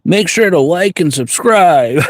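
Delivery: high, slow YouTuber outro; hammed-up YouTuber plug. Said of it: "do the YouTuber thing".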